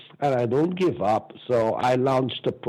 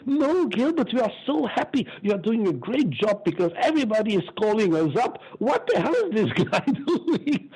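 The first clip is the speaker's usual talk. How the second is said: higher, chuckling official voice; a retold, laughing reassurance from the official; amused official; 7 semitones higher than usual. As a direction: play the delighted official laughing it off. Official delighted, quoted with laughter.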